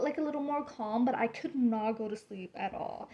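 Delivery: usual speaking voice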